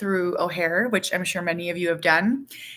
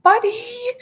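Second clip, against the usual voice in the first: high, loud, dismayed call; exasperated high-pitched mom voice; retold dismayed mom; 7 dB louder than usual. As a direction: exclaim it high, pleading with a kid. Mom retells reacting to son's picking.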